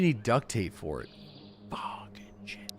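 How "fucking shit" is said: whispered curse. Real, not acted. Missing duct tape for crafting.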